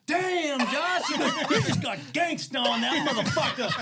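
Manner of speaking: loud, high amazed exclamation with laughter; a coworker's impressed reaction, laughing. Retold or imitated. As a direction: kid cheering Josh on, amazed and laughing.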